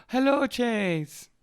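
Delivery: high, slow, drawn-out "Hello, Chase"; imitating Irene. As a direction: answer sweetly and slowly, in her voice. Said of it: "And she would go".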